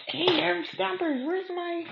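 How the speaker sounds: high, hammed-up lost voice; mock helpless crafter. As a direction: whine about the lost snips. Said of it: "I'm gonna be like".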